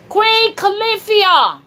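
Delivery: high, slow, loud call; shouting. Shown as a demonstration. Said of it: "Say, say".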